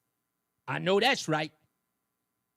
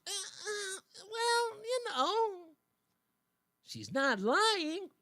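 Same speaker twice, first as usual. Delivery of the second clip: much higher, halting, sheepish excuse; hesitant mock evasive voice; mock hemming Democrat; 11 semitones higher, much slower than usual. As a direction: stammer out a lame dodge, slow at the end. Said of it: "They shrug their shoulders".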